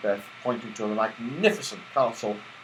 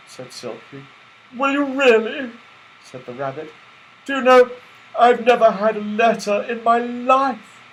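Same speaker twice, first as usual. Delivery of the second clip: toy rabbit voice; 9 semitones higher than usual. A narrator's reading.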